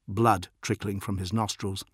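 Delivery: ordinary delivery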